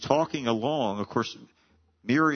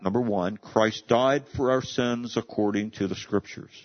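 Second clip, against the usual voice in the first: ordinary talk, nothing marked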